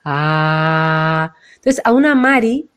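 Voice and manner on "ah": low heart Ah. Shown as a demonstration. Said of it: "tiene que ver con el chakra corazón".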